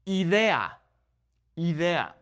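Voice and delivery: Idea as ee-DEH-ah, twice; Spanish "Idea" with pure vowels; Spanish pronunciation. Shown as a demonstration. Teacher models vowels, Spanish Idea. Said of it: "focus on the vowel sounds".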